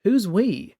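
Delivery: light, amused mocking. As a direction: tease her with a grin. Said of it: "He seemed amused".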